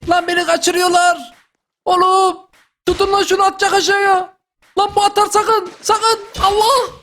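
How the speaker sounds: frantic shouts as they drag him off; real alarm, voice up high; panicked high yelling. Real, not acted. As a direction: pitch shooting up, calling for help. Panic as he is carried off.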